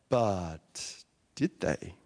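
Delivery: low, drawn-out rhetorical question; slow teasing question. Acted. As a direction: drop low and slow, let the doubt hang. Dramatic pause after "dot dot dot.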